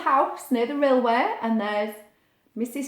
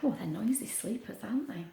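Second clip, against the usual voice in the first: whisper; 10 dB quieter than usual. A narrator's reading. Aside about sleeping crooks.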